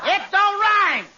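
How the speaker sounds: indignant protest. Acted. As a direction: protest that it doesn't rhyme, drawn out. [name] protests the poem.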